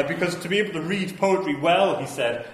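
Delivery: usual speaking voice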